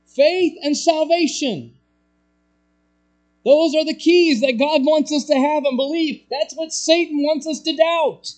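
high, loud, driving pulpit shout; full-force preaching. Real, not acted. Preacher warns congregation of Satan's doubts, shouting at full force. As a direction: thunder it at the congregation, pitch held high.

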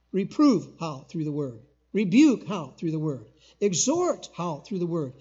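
loud call and answer, each command hit hard; preaching. Acted. Preacher firing questions at the church, loud preaching. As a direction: preach it out to the congregation with force. Preacher drives home scripture.

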